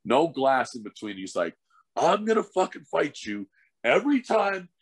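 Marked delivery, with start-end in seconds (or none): 2.0-4.7 higher-pitched mock taunt from the rival; imitating opponent's threat. Retelling the rival fighter's threat from the penalty box, mock taunt. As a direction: sneer the threat up high like the rival.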